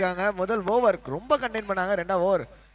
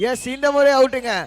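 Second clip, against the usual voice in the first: raised, loud commentary call; live cricket call, high and loud; excited confirmation; 4 semitones higher, 6 dB louder than usual. Real, not acted. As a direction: announce the wicket with a punch.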